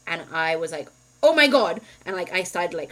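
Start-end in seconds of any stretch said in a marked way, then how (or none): 1.2-1.8 loud, high retold cry of alarm. Retelling finding husband barely breathing, panicked. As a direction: exclaim it in re-enacted panic.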